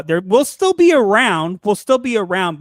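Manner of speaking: "still" and "be" drawn out slowly and loudly; emphatic reassurance. Real, not acted. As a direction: lean on "still be around", slowly and firmly. Reassuring listeners they remain.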